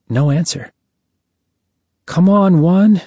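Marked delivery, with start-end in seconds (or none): 2.1-3.0 higher, slightly raised urging call; a little louder. A narrator's reading. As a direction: call out to someone who isn't answering, a touch louder. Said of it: "A little louder this time".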